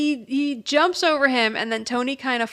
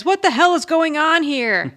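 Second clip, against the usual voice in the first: exasperated outburst; 5 semitones higher, 5 dB louder than usual. Retold or imitated. Tony confronting the bizarre confession.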